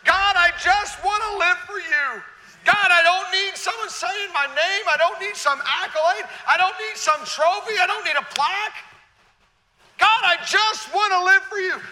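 loud, high, slow cry of surrender to God; Isaiah's impassioned prayer. Acted. Preacher voicing Isaiah crying out to God, loud and high. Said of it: "And Isaiah's answer".

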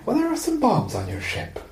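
Irish villain on the phone, first try; Irish accent. Retold or imitated. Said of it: "I've been practicing the accent".

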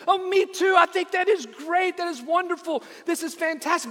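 very high, gushing delight; overjoyed. Acted. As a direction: gush like you found a best friend. Rescuer overjoyed at agreement.

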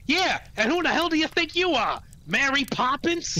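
very high, loud cartoon retort; indignant Booster. Booster answering the man, high loud mock indignation. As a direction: throw it back in a high put-on voice. Booster snapping back in acted exchange.

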